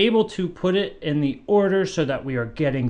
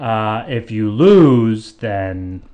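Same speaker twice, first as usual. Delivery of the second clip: slow, heavy and louder; ominous buildup; 3 semitones lower, 6 dB louder, much slower than usual. Acted. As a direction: drag it out like a threat. Host sets up an ominous penalty.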